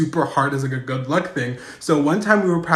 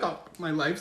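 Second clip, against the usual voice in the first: soft, deflated aside; resigned dismay; 11 dB quieter than usual. Retold or imitated. Resigned reaction to being led off.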